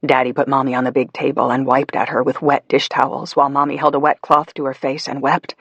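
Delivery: ordinary delivery, nothing marked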